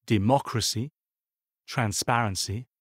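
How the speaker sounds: "democracy, transparency", each stressed third syllable from the end; antepenultimate-syllable stress. Shown as a demonstration. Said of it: "sont accentués sur l'avant-avant-dernière syllabe".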